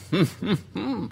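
breaking into a short chuckle; laughing. Real, not acted. Cracking up at his own Southern reading, a short laugh.

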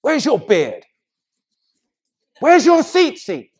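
high-pitched demanding voice; mock legalist critic. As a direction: call them out in a high, hammed-up scolding voice. Mimics legalistic critics challenging others.

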